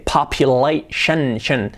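"population" slowly, broken before "shen"; SHUN ending. Shown as a demonstration. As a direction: isolate "shen" after "population". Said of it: "Las acabas en tion".

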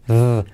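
"Th" sonora y grave, zumbando; sonido TH. Shown as a demonstration. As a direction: haz vibrar "Th" como una d suave. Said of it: "Semiclave T H". ("Th" sostenida, con voz)

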